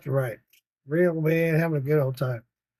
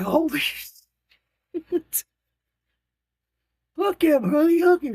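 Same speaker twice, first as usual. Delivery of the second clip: much higher, slow amazed then urgent; excited; 12 semitones higher, much slower than usual. Retold or imitated. Grandpa to granddaughter as the bass strikes, excited urging. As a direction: shout at her to set the hook. Grandpa sees fish take line.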